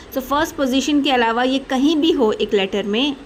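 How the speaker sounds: ordinary talk, nothing marked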